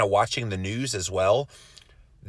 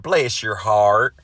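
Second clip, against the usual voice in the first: slow, louder Southern drawl on "Bless your heart"; Southern accent; 7 dB louder, much slower than usual. Retold or imitated. Reading a viewer's comment aloud, in a slow Southern accent. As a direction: lay on a syrupy Southern accent, stretching it out. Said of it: "said in a Southern accent".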